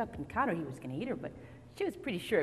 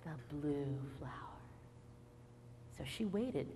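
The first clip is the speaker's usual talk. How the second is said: usual speaking voice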